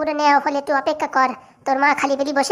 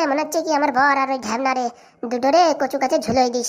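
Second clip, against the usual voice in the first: usual speaking voice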